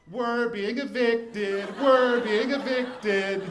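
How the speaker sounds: high, slow, loud joyful scream; a child's delighted scream, drawn out; gleeful screaming. Retold or imitated. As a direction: shout it to the whole playground, thrilled. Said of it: "screaming at the top of my lungs".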